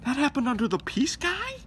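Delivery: high loud disbelieving question; incredulous. Acted. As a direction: ask it in outraged disbelief. Same words, loud incredulous.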